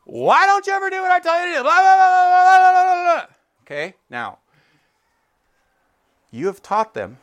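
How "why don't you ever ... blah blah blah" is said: very high, loud, fast screaming rant; hammed-up furious parent shouting blah blah; furious dad yelling. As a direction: explode like a dad who has lost it. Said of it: "in a fit of fury".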